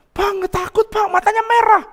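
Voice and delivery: high-pitched acted alarm; imitating frightened maid. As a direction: act out the maid's panicked report, voice way up. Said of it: "pembantu saya ini ketakutan".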